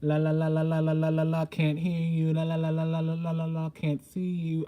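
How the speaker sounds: rapid la-la-la chant on one held note; singsong monotone, stretching the last words; mock refusing to listen. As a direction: drown them out like a stubborn kid, fingers in ears. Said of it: "you're closing your eyes".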